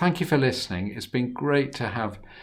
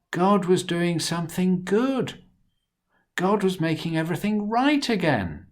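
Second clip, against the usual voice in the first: reassuring; 7 semitones higher, 4 dB louder than usual. Acted. Joseph comforts brothers.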